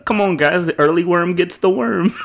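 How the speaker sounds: lightly voiced Office character pep talk; quoting The Office. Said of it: "I quote The Office so much".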